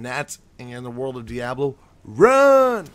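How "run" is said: loud high warning; shouting run. Acted. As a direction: yell it as a warning. Warning to flee gnats.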